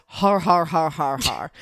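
lightly imitated assistant reading typed laughs; loud at first, then dropping away, mock-robot laughs; Siri reading laughter. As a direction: read each "har" out like a text. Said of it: "I love when it reads out people's texts".

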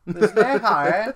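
loud, high, drawn-out mock English accent. Echoing a friend's film quote, hammed-up English accent. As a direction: exaggerate a silly English accent at full volume. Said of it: "he's doing a mocking English accent".

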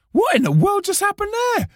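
very high, incredulous mock squeal; mock astonishment. Performer amazed the crowd suddenly lit up, high astonishment. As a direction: act out total bafflement in a high voice.

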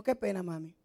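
low, hushed disappointment of a retold child; sad, disillusioned. Son let down by his mother's answer, quietly sad. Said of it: "con una mirada tan triste".

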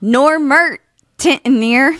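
Normertentneer drawn out very slowly; slow enunciated. Shown as a demonstration. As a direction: stretch Normertentneer slowly, syllable by syllable. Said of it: "One more time for Baz".

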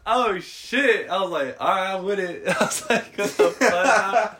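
high, loud retold reaction; stunned excitement. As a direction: build to a drawn-out high exclamation. Acts out his stunned, excited reaction.

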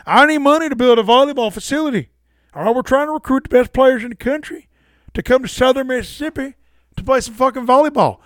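hammed-up but light Favre voice; Brett Favre impression. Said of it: "It's all gonna be Jim Ross".

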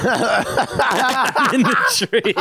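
giggling through the words; laughing while talking. Pretend. Laughing mock line.

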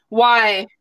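normal talking voice

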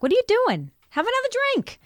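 high mock drunk coaxing; mock tipsy pushing. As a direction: egg him on to drink, high and teasing. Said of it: "'cause I want him to drink like I'm drinking".

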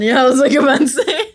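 loud high laughing speech; talking through a laugh. Real, not acted. Laughing at co-host choking.

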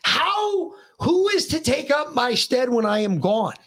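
high-pitched, impassioned retold rant; pissed-off tirade. Dad rants at son about his generation, pissed-off tirade. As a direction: re-enact a furious lecture, drawing out key words. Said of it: "went into another tirade because I was fucking pissed".

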